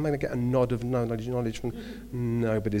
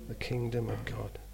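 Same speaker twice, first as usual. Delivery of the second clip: soft scripture quote; 3 semitones lower, 11 dB quieter than usual. Acted. Quoting scripture softly.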